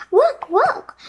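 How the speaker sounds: high, loud eager cry; excited call. Acted. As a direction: call out urgently to the bird. Annie calling urgently to the bird.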